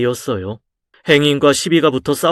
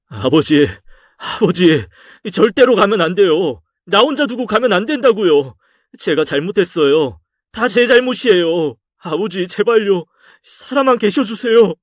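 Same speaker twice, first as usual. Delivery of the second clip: desperate pleading; 7 semitones higher than usual. Acted. Son begging his dead father not to leave, desperate and rising. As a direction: beg him not to go, higher and higher.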